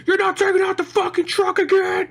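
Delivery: high, loud imitation of a yelling father; hammed-up parental shouting; mock dad yell. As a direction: yell it like an irate dad in the next room. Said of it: "his dad just yelling".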